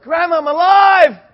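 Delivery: high, drawn-out shout; excited yelling back. Retold or imitated. Boy protests to scolding grandma, high excited shout. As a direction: holler it slowly over the scolding. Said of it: "yelling at me".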